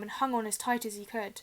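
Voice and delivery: ordinary delivery, nothing marked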